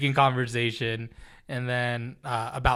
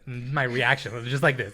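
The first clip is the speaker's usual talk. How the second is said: ordinary talk, nothing marked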